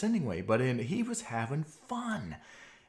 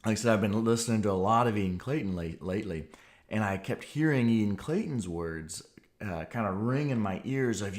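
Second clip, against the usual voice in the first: ordinary delivery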